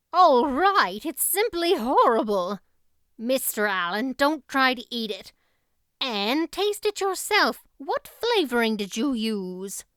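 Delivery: loud, scolding housekeeper; a stern woman, loud and appalled; indignant Marilla. Acted. Marilla scolds over the cake.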